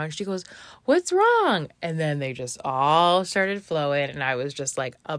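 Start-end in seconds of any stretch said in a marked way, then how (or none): 0.8-1.7 high, loud, drawn-out question; imitated concerned friend. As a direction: mimic a friend's alarmed concern.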